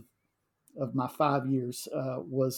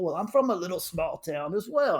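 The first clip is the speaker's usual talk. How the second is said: director being friendly; 5 semitones higher, 4 dB louder than usual. Retold or imitated. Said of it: "he came up to me and goes".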